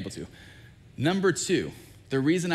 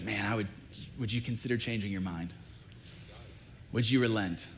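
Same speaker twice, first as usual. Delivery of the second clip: soft, lowered, hesitant appeal; tentative request; 3 semitones lower, 6 dB quieter than usual. Retold or imitated. Moses carefully asking God to change his mind, tentative. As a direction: ask gently, as if testing the water.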